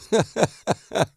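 a burst of high laughter; bright chuckle at the punchline; laughing at own joke. Real, not acted.

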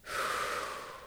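imitating a long soft sigh; calm exhale. Showing how plungers get in, calm exhale. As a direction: breathe out softly and slowly, unvoiced by effort. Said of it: "they get in and they go".

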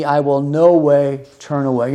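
ordinary talk, nothing marked

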